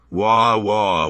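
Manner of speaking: a mock crybaby wailing wah wah at Obama. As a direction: bawl wah wah like a whiny baby. Mocking McConnell as crybaby.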